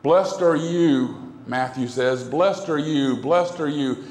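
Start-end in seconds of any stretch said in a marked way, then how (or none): none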